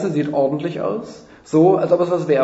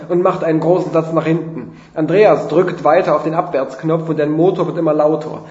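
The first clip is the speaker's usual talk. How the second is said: usual speaking voice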